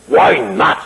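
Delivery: lightly imitated grand actor; Sir Cedric Hardwicke. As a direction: put a hint of the big actor's voice on it. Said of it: "The big, beautiful, deep voice".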